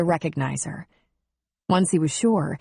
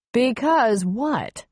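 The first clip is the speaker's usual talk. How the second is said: slow, drawn-out, higher challenge; pointed, slow prompt; pressing question; 6 semitones higher, much slower than usual. Acted. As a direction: lightly push him to finish. Pressing him to say it.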